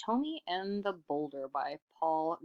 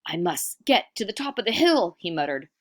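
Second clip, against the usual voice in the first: louder, higher running voice; mild panic; 4 semitones higher, 6 dB louder than usual. Acted. Iktomi flees the boulder in mild panic, louder. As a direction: hurry up the hill.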